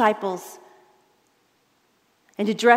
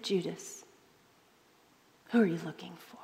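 soft intimate question; 5 semitones lower, 12 dB quieter than usual. Acted. Imagined Jesus speaking softly.

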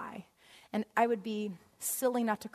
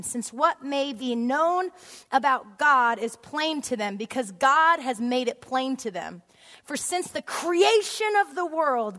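slow, raised reading of scripture; 4 semitones higher, 6 dB louder than usual. Acted. Preacher reading scripture aloud to the congregation, proclaiming. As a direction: proclaim the verse slowly and loud. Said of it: "Chapter one".

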